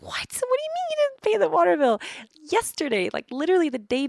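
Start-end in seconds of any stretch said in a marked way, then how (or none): 0.0-2.0 high-pitched retold disbelief; re-enacting a shocked, high-pitched demand; incredulous outburst. Retold reaction to unpaid water bill.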